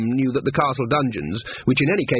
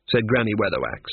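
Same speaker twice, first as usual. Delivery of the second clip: usual speaking voice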